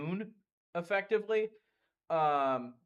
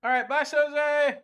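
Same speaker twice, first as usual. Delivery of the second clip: calling out; 11 semitones higher, 9 dB louder, slower than usual. Real, not acted. Calling goodbye to departing friend.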